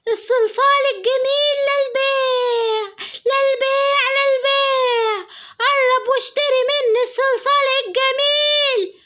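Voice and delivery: high, slow sing-song hawker's chant with a cry in it; street seller call. Acted. Girl selling clay under the house at night, slow sing-song chant. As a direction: chant your wares to the street. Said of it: "عماله تقول صلصال للبيع".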